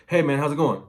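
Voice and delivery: bright, loud and high hello; high-energy greeting. Retold or imitated. Customer answering the phone, loud and high. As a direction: greet him like you're thrilled to hear from him. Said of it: "they're like".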